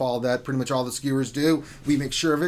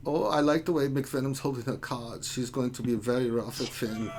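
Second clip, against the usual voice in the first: put-on sly, suggestive German accent; naughty German guy accent; 3 semitones lower than usual. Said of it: "when you say it in a German accent".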